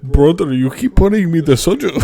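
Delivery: hammed-up bad Russian accent; a clumsy put-on Russian accent; terrible fake accent. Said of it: "That's a terrible accent".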